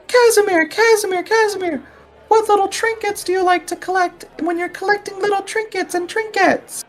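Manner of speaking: squeaky high chanting caller. Acted. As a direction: call the name in a high squeal. GM voices a crowd member.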